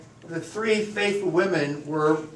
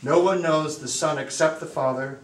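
measured Gospel reading with long pauses; formal, unhurried liturgical reading; solemn scripture reading; 3 semitones lower than usual. Acted. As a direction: read it out reverently to a congregation. Reads the Gospel aloud.

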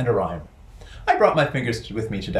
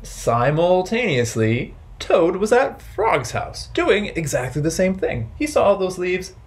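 lively storytime narration; story for children; 5 semitones higher than usual. A narrator's reading. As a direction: tell it to a circle of kids.

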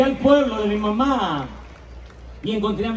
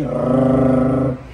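fan whirr; 5 semitones lower, 5 dB louder than usual. Shown as a demonstration. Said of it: "le estaba dando el ventilador así".

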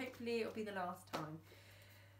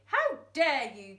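loud indignant outburst; offended cry; 3 semitones higher, 9 dB louder than usual. Acted. Thumbs thinking he is called a pig, indignant. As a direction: take huge offence. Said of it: "cried Thumbs".

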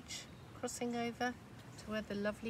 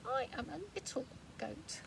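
higher, soft little goat; small and timid, much softer; tiny goat voice; 5 semitones higher, 6 dB quieter than usual. Acted. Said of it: "says the wee tiny one here".

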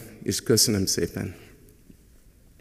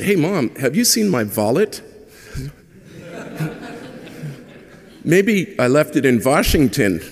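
put-on teasing teenager; 6 semitones higher, 3 dB louder than usual. Teenage son teasing mom's accent.

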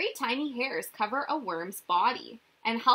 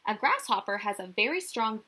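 normal talking voice

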